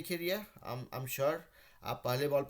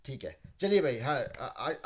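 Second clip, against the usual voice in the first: usual speaking voice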